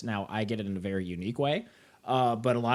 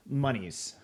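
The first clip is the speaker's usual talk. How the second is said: ordinary talk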